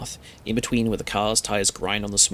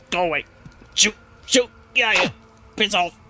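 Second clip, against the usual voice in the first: high, loud shooing in short bursts; hammed-up agitated dismissal, pitched high; frantic shooing; 10 semitones higher, 4 dB louder than usual. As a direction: shoo an intruder off urgently. Shooing an unexpected caller away.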